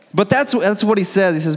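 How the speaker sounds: usual speaking voice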